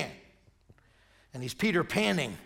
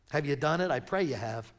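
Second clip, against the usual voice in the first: ordinary delivery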